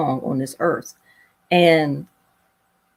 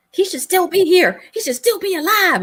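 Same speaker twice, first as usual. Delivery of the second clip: high pleading inner voice, much higher; grieving protest; 12 semitones higher than usual. Retold or imitated. Daughter grieving her father, high pleading protest. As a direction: cry out that he should still be here.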